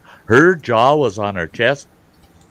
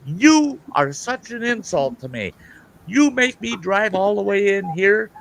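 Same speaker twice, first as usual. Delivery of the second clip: retold angry tirade; 7 semitones higher than usual. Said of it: "I'm bent out of shape".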